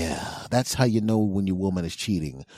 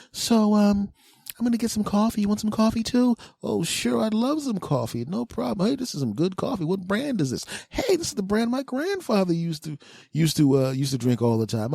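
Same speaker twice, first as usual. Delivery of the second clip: high, breezy mock girlfriend voice; fake casual chat; 12 semitones higher than usual. Girlfriend distracting him with coffee talk, high breezy voice. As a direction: play innocent, chatting as if nothing happened.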